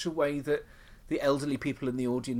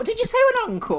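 high, loud astonished squeak; mock disbelief; 8 dB louder, faster than usual. Real, not acted. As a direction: play it mock-scandalised. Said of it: "mock indignant".

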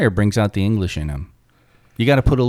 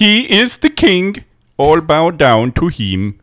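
loud, higher mock-Scandinavian accent; Swedish chef accent; 6 semitones higher, 6 dB louder than usual. Hailing the king of Norway, Swedish chef accent. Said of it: "it's a Swedish chef you're doing".

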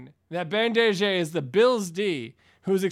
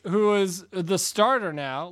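ordinary delivery

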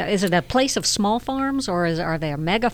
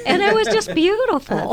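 high, laughing delight; delighted laugh remembering the cabbie; 13 semitones higher than usual. Real, not acted. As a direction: laugh through the fond memory. Laughing at fond memory.

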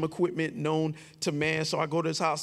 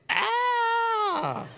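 scream for help. Retold or imitated. Screaming for his brother on the couch. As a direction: yell out so someone comes to help. Still screaming, nobody coming.

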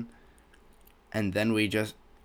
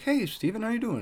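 high, sing-out hello; friendly greeting; 11 semitones higher than usual. Retold or imitated. Boss greeting former employee in the aisles, friendly. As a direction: imitate a boss's cheery high-pitched hello.

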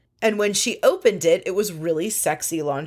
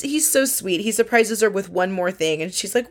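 usual speaking voice

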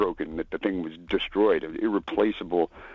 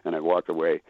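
ordinary delivery

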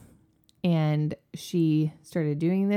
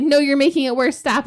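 louder, higher retold scolding; scolding mom; 6 semitones higher, 5 dB louder than usual. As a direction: snap at the kid to stop. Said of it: "And I was like".

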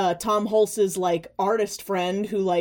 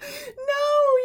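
mock horror. Mock horror at casting news.

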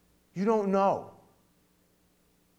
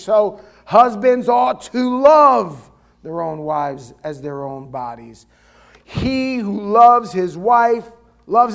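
scripture reading; 7 dB louder than usual. Acted. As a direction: proclaim each verse to the congregation. Reads Ephesians aloud with force.

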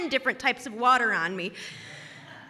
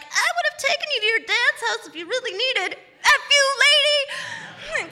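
mocking bus driver; 11 semitones higher than usual. Bus driver jeers at the soaked girl, high and whiny. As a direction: sneer it out like a petty driver. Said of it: "the bus driver being like".

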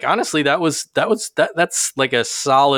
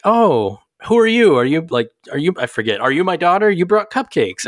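put-on high, confused little old lady; 7 semitones higher, 3 dB louder than usual. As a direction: play a forgetful old woman. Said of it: "the old lady's like".

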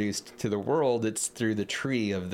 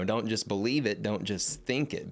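normal talking voice

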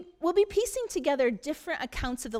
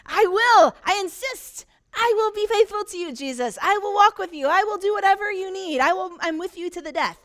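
high, loud, rushing pledges; nervous babbling; 7 semitones higher, 8 dB louder than usual. Acted. Insecure Peter to Jesus, high loud babbling. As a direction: keep talking to fill the awkwardness. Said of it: "He just keeps on talking".

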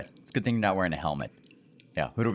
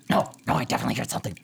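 goblin insisting; 4 semitones higher, much faster than usual. Acted. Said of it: "you hear the goblins go".

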